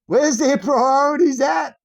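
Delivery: loud, high, slow shout with a laugh; laugh, then shout it out in outrage; outraged shout. Real, not acted. Outraged rant.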